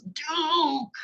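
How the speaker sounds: very high, drawn-out scolding cry; mock really mad. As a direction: play an exasperated father yelling at the dog. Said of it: "the father gets really mad this time".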